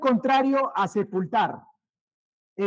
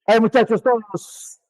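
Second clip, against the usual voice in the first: loud call to the whole class; 9 dB louder than usual. Real, not acted. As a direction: raise your voice to get every student's attention. Teacher calls out to whole class.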